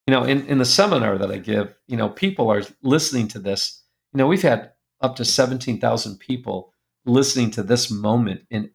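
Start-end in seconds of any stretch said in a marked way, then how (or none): none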